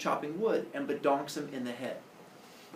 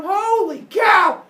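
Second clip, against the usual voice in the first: high, loud, drawn-out cry of shock; shocked exclamation; 13 dB louder, much slower than usual. Acted. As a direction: break off into a hammed-up yell of alarm. Finds house invaded by monsters.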